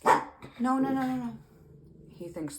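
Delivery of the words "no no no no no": sharp higher rapid no's; alarmed repeated no; startled no. Real, not acted. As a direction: stop something suddenly. Sudden interruption mid-sentence.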